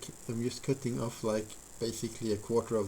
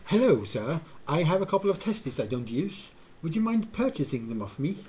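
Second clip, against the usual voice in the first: higher, louder hammed-up British gent; mock British accent; 5 semitones higher, 5 dB louder than usual. Streamer voicing a man offering to sell his organs, in a mock British accent. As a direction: put on a posh British seller voice, playing it up. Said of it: "I don't know why that would be done in British".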